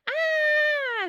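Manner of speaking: long, very high, loud scream; group scream. Acted. The gang racing around the yard, screaming. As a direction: scream like a gang of wild kids. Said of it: "screamed the gang".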